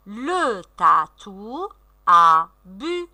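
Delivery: very slow, each word stretched out; "Le tatou a bu" read slowly with held vowels; syllable-by-syllable reading. Shown as a demonstration. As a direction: model slow decoding for beginning readers. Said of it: "on lit le mot".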